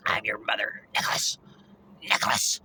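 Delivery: creepy whispered name calling; mother's whisper. Retold or imitated. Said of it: "especially like that".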